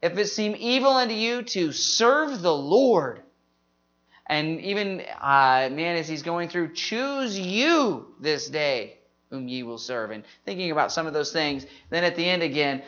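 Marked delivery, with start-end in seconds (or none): 0.0-3.2 "serve the Lord" stressed, with "serve" rising out; emphasis on key words. Shown as a demonstration. Showing how to memorize the verse, stress on "serve the Lord". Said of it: "put some emphasis on some certain words". 6.7-10.1 slow, with "you" lifted and held; emphasis on YOU. Shown as a demonstration. Showing how to memorize the verse, stress on "you". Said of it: "put some emphasis on some certain words".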